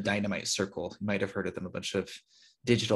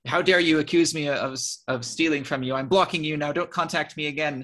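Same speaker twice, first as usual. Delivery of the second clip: mild mock outraged yell, raised pitch; retold screaming; 6 semitones higher, 4 dB louder than usual. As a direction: imitate an indignant rant, higher than usual. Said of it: "he was, like, screaming".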